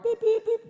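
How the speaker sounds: very high, loud beep imitation; heart monitor beeps. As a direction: mimic a monitor. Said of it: "your heart".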